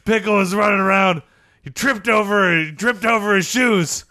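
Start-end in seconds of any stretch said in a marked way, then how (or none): none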